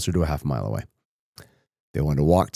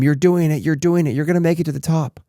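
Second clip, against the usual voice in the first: a higher, excited mild yell, not full volume; big brother hyping up the little one; five-year-old brother shouting encouragement up the hill; mock screaming; 9 semitones higher than usual. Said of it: "brother is screaming at him".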